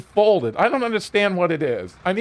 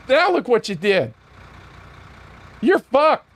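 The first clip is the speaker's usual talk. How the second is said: gloating taunt; 5 semitones higher than usual. Retold or imitated. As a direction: rub it in like a smug deputy. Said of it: "That's exactly what he said".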